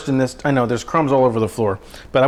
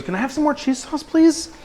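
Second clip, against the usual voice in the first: much higher, sweetly pleading mock voice; mock wife voice; 12 semitones higher than usual. His wife begging for more cheese sauce, high mock plea. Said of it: "And she goes".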